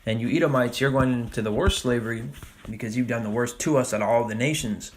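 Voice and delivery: ordinary talk, nothing marked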